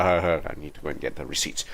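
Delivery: normal talking voice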